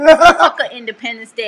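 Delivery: loud, laughing mock quote; half-shouted teasing quote. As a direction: blurt it out through laughter. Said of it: "'cause you said".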